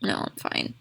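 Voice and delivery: whispered aside; whispering. Real, not acted. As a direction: drop to an unvoiced aside. Aside to someone off camera.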